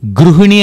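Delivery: గృహిణి said "gruhini"; u sound after gr in గృహిణి; Telugu pronunciation. Shown as a demonstration. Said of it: "గృహిణి అని తెలుగులో అంటాం". (గృహిణి తెలుగులోలా "గ్రు"తో పలకండి)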